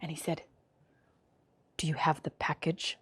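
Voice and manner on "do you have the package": hushed, low Russian spy voice; secretive Russian caller, very soft; thick Russian accent. Retold or imitated. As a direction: murmur it in a mock Russian accent. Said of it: "this thick, like, Russian accent".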